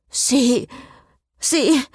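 high, stammering scold; scolding splutter. A narrator's reading. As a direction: sputter with rising outrage. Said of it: "schimpfte Cecile". (empört stammelnd geschimpft)

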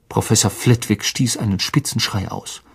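ordinary delivery, nothing marked

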